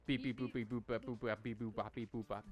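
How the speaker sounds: rattled-off robot beeps and bops; lightly voiced robot gibberish; playful robot talk. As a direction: babble a string of robot beep-boop syllables. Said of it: "talking like robots".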